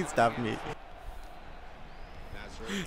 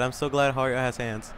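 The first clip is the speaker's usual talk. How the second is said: ordinary talk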